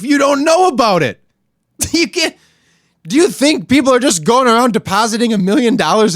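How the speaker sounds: sarcastic outrage over stolen money; incredulous rant. Real, not acted. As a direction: scoff at how absurd it is. Mocking disbelief at Favre scandal.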